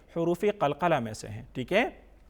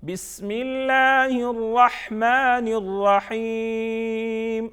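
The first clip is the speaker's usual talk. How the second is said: بسم اللہ running on into الرحمن without a pause; higher slow connected chant, الرحیم held long; recited joined together; 4 semitones higher, 4 dB louder, much slower than usual. Shown as a demonstration. Teacher reading tasmiya whole, joined-up slow recitation. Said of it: "Tasmiya".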